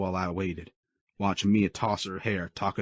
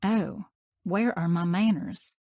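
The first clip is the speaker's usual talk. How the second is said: put-on much higher, slow sarcastic girl's voice; sassy; 11 semitones higher, slower than usual. A narrator's reading. Mia mocking her own politeness, high and sassy. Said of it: "she sassed".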